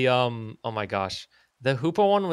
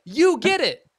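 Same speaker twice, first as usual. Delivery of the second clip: much higher, loud triumphant cry; thrilled someone finally gets it; high, loud vindicated yell; excited agreement; 13 semitones higher, 5 dB louder, slower than usual. Real, not acted. Friend agrees about Keldeo.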